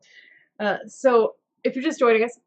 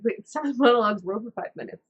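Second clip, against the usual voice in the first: usual speaking voice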